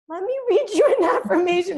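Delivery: high, loud mock mom; eager mom impression. Daughter imitating her mom answering her tears with an affirmation. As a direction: offer it brightly like a fussing mother.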